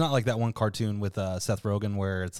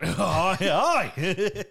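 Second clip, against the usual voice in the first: high, laughing Australian call; mock Australian; 8 semitones higher, 4 dB louder than usual. Host goads co-host to try the accent, mock Australian. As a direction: yelp it in a hammed-up Australian accent.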